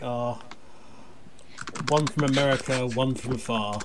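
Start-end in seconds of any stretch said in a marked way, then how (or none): none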